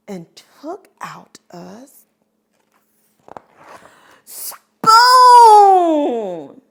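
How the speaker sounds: hushed pause, then loud high payoff; whisper, wait, then burst out; story suspense reveal; suspense build. Shown as a demonstration.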